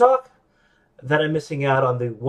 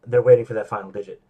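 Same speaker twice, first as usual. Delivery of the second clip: usual speaking voice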